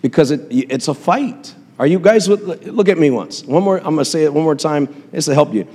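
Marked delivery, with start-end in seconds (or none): none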